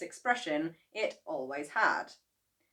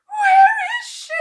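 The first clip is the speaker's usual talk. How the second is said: very high, loud, panicked cry; frantic cry; 17 dB louder than usual. Acted. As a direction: cry out searching for her mother. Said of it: "cried Missus Cranky".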